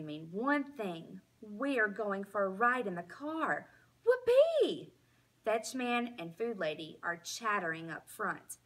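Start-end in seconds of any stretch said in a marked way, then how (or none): 4.0-4.9 very high, loud joyful whoop; a dog bursting with glee; excited whoop. A narrator's reading. Dog hearing the car keys, whooping with joy.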